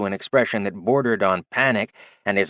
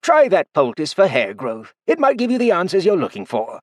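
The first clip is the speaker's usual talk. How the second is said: a hint snide; 9 semitones higher, 3 dB louder than usual. Acted. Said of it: "voice just a hint shy of snide".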